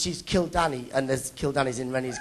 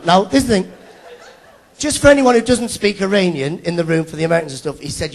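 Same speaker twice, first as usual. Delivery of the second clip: ordinary talk, nothing marked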